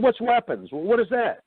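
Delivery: high mock confusion; pretending not to know to the cop; mock clueless. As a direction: play dumb about weapons. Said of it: "I said".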